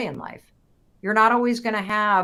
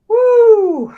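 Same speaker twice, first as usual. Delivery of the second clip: sudden high cry of release; nervous breakdown; 14 dB louder than usual. Real, not acted. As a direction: let out a loud shaky woo. Sudden outburst mid-talk.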